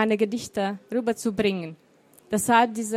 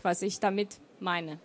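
ordinary delivery

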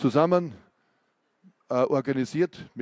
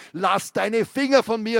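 higher, louder cry to be let go; shouting; 7 semitones higher, 4 dB louder than usual. Acted. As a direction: snap it out as a yell. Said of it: "schrie ihn Renker an".

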